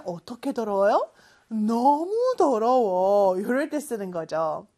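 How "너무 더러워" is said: slow, louder stress on 너무; 너무 drawn out and pressed hard; emphatic very dirty. Shown as a demonstration. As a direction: lean on 너무 to show how dirty. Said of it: "so는 어떤 상태를 좀 더 강조".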